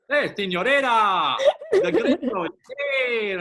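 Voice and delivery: a cheesy tourist showing up at the party; hammed-up gringo. Put-on party guy voice.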